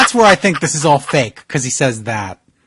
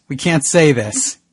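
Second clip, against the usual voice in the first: usual speaking voice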